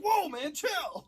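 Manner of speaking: high, loud surprised whoa; squeaky startled voice; startled squeak. Retold or imitated. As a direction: jump back in mock fright. Actor startled by cough.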